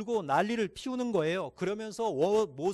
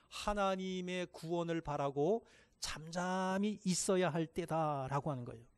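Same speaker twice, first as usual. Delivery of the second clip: normal talking voice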